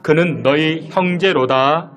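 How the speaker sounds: slow, measured Bible reading; scripture reading. Acted. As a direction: read the verse slowly and solemnly. Reads scripture aloud.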